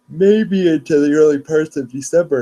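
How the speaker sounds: talking through a real yawn. Real, not acted. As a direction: let a yawn stretch and lift the words. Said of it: "I'm sorry I'm yawning".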